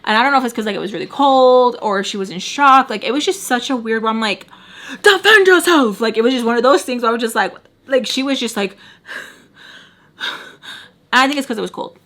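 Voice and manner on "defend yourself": much higher, louder yelled plea; yelling at Sam to defend herself; urgent shout. Retold or imitated. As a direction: shout it to make her fight back. Urging Sam to fight back.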